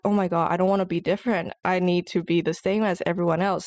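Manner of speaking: ordinary talk, nothing marked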